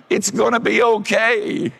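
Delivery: high, loud, soothing mock lady voice; comforting old lady. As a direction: pat her back with the words. Repeats the lady's comfort.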